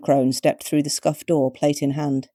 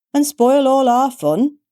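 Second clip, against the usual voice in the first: put-on much higher, slow, loud old-woman whine; mock-disappointed crone; 10 semitones higher, 6 dB louder, slower than usual. A narrator's reading. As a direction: pout it like a spoilsport granny.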